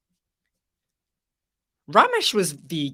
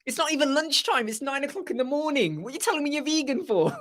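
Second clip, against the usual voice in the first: much higher, incredulous outrage; mock bewildered; 8 semitones higher than usual. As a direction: play it baffled and exasperated, voice way up. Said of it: "You go".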